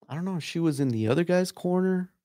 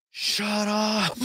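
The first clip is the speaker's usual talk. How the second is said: higher, half-acted fed-up grunt of a man in guard; mock fed-up guy; 7 semitones higher than usual. Grappler in guard snapping at girlfriend, mild imitation. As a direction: toss it off like a grappler sick of his girlfriend. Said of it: "he looks up and he's like".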